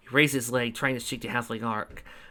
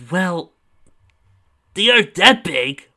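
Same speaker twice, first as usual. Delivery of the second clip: loud boast; 6 semitones higher, 10 dB louder, slower than usual. Acted.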